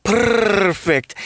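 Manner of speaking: high, purred rolling R. Acted. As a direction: roll the R like a cat's purr.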